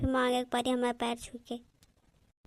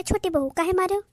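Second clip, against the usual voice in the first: raised, louder scolding question; stern confrontation; 5 semitones higher, 5 dB louder than usual. Acted. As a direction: confront the daughter-in-law sharply. Same confrontation of younger daughter-in-law.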